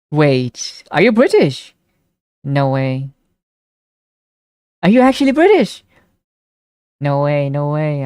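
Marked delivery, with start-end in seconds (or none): 0.9-1.7 high, louder disbelieving question; surprised. Real, not acted. Streamer surprised a viewer might be British, high and louder. Chatter reveals they're not American. 4.8-5.8 much higher, louder disbelief; can't believe it, pitch jumps up; astonished. Real, not acted. Streamer astonished to learn a viewer is British, high and loud. Chatter reveals nationality.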